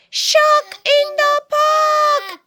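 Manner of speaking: slow, high, loud shout; top of his voice. Acted. Boy warning the park, shouting at full voice. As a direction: bellow it as loud as you can. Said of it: "at the top of his voice".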